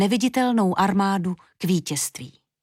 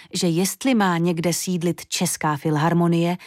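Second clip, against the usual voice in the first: ordinary delivery, nothing marked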